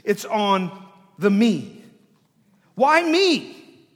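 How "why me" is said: "me" thrown up high and very loud; high, loud emphasis on "me"; stress on me. Shown as a demonstration. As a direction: hit "me" with a raised, forceful voice. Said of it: "The emphasis here is ... on the me".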